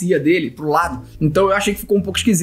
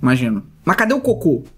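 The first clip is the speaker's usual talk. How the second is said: ordinary delivery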